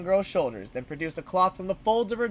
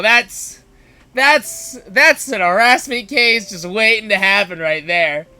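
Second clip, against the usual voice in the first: loud, slow exasperated aside; exasperated comment; 2 semitones higher, 11 dB louder, slower than usual. Real, not acted. Narrator calls out the bully's harassment, loudly and slowly. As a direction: push every word out in disbelief. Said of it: "narrator comment on harassment".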